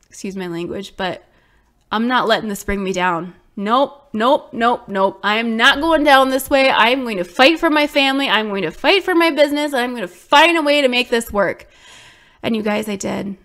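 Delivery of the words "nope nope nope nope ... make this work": louder and higher, determined; fierce fighting vow; defiant resolve. Real, not acted. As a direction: stake your claim, refusing to give up.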